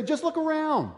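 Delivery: high, whining, mocking complaint; mock the grumbling people; whiny complaint. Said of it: "And they'd say".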